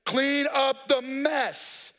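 put-on slow, firm dad giving an order to muddy kids; stern dad. Father ordering muddy boys to clean up, slow and firm. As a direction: lay down the law slowly, like a father in charge. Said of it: "daddy with authority says".

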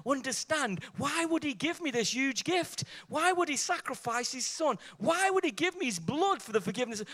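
preacher's questions climbing steadily in pitch; impassioned pleading sermon. Real, not acted. Preacher builds rhetorical questions to a climax.

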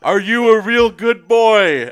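high, loud, drawn-out gushing question; dog-praising voice. Acted. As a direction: gush at a dog, hammed up. Ceremonial question acted as to dog.

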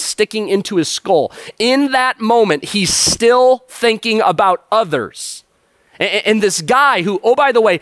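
preaching at full force. Acted. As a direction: drive the point home to the congregation. Preacher's intense build-up on Jesus.

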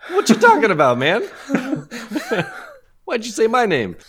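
high loud mock protest; hammed-up indignant protest; mock indignant Mac. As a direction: play Mac caught off guard. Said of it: "Mac's face is also kinda like".

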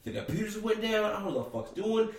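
mock panicked worker; imitating panicked cashier. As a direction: play the clueless flustered worker. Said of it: "He's like".

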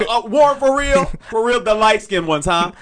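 high-pitched mock accusation; Lacey. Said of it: "can't you hear Lacey's voice".

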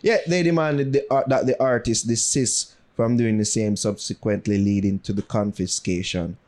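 low-pitched, measured news reading. Real, not acted. Reads out the news story.

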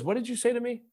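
high, hushed, puzzled question; puzzled stranger. Retold or imitated. Homeless man turning back to the friend, high hushed puzzlement. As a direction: ask it softly, high and confused.

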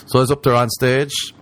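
ordinary talk, nothing marked

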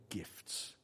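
a hushed whisper on the crucial word gifts. Shown as a demonstration. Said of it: "because of that crucial word".

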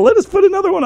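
high, fast, eager begging; eager childlike plea. Retold or imitated. Partners beg the teller to let them help, high and eager. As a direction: beg like a keen little kid.

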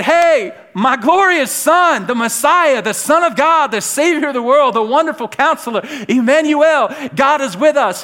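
high, loud heralding of titles; booming proclamation. Acted. God announcing his Son from heaven, booming proclamation. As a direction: proclaim each title at full preaching force.

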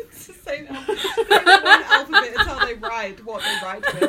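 loud, high, laughing through the words; laughing hard. Real, not acted. Friend corrects another about the alphabet, laughing loudly. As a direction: explain it while cracking up.

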